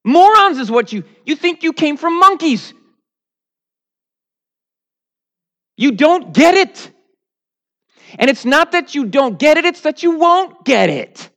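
booming mock-divine rebuke, shouted; God shouting. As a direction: yell down at them like an outraged God. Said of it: "And God goes".

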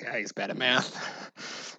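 deadpan listener. Retold or imitated. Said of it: "You're just like".

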